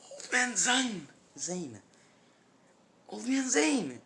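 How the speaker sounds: loud, high cry for help; high-pitched desperate shout; screaming out. Acted. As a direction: scream the name out at the top of your voice. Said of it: "to scream in pain".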